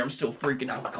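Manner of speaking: ordinary talk, nothing marked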